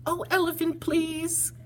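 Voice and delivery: very high little mouse voice, sinking into a plea; mouse shouting. Acted. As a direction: squeak it out as a small indignant mouse, then beg. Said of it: "he sat up and shouted".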